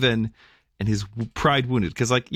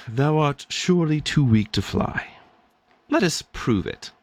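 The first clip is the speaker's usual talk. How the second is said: a lightly higher, proper English angel; proper English accent; 6 semitones higher than usual. Acted. Angel patiently offers the raven a test, in a proper English accent. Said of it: "he had a proper English accent".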